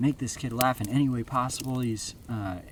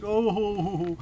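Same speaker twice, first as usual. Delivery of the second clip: high, hooting "oh-ho-ho"; silly high-pitched tumbling noise; clownish pretend-fall cry; 10 semitones higher, 4 dB louder than usual. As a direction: hoot like a clown taking a fake tumble. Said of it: "pretend falls. He's like".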